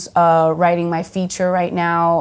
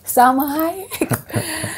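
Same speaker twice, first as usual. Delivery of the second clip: drawn-out, much higher and very slow; awestruck; 8 semitones higher, much slower than usual. Retold or imitated. Reliving Salma posting about her, a slow awestruck sigh of the name. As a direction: savor the name in total awe.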